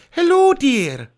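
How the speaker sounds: a gentler nanny voice; Mrs Doubtfire, better. Acted. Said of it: "That's better".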